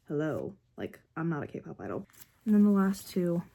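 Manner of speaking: ordinary talk, nothing marked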